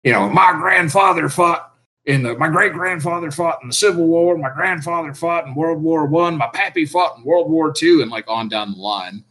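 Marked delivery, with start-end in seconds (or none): 0.3-8.0 high-pitched mock Southern gentleman reciting his lineage; rough imitation of a posh Southern accent; Southern old-money accent. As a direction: put on a proud old Southern family accent. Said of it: "much more refined than I could ever make that accent".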